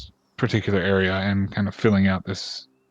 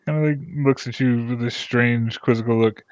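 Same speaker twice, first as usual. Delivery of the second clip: usual speaking voice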